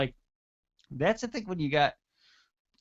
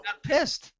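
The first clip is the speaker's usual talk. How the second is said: high-pitched amazed exclamation; stunned reaction; 12 semitones higher than usual. Retold or imitated. Fan reliving Goldust's angry promo, stunned.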